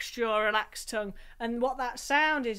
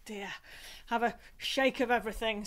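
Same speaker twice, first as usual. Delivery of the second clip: ordinary talk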